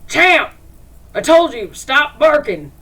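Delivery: loud, high shout at the hound; angry master hollering at his hellhound; man yelling at dog. Acted. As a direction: bark the command at the dog. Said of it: "And the man goes".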